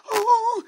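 high, very loud mock wail; exaggerated "oh" of sobbing; imitated crying wail. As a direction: burst out in hammed-up weeping. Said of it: "nangis pendetanya".

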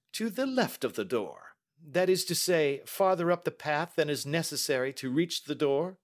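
high, brisk questioning detective; Holmes. Acted. Detective questioning the niece about the maid, probing.

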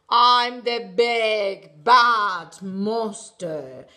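low, slow, loud monster growl; big bad monster. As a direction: boom it out like a troll. Teacher voicing the monster.

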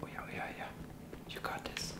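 hushed game whisper. Acted. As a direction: whisper so they don't hear you. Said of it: "leise redet bei sowas".

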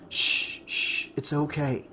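soft, hushed reassurance; soothing. A narrator's reading. Nick calms crying Tina as she falls into his arms, soothing. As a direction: hush and comfort gently, softly.

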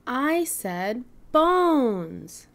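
very slow, bones drawn out; slow correction. Acted. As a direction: patiently correct the word bones. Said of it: "said Stego".

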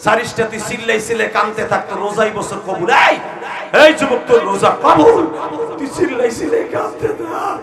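loud urging; fiery preaching. Real, not acted. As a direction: keep driving them to cry out. Preaching at full force.

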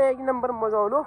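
usual speaking voice

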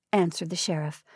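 plain reading voice

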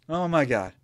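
ordinary talk

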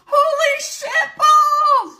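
high, loud, drawn-out exclamation; retold shocked yell. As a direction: act out the moment of shock, stretching each word. Acted shock at the alert.

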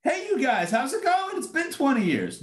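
high, bright-voiced chummy hello; friendly casual greeting. Joseph imagined greeting his brothers cheerfully, high and chummy. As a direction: greet them like old pals, way up high. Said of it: "he didn't say".

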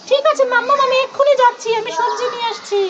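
put-on high, eager little-kid voice; eager child figure. Toy child agreeing to fetch vegetables, in a high eager voice. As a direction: chirp it high like an obedient small child.

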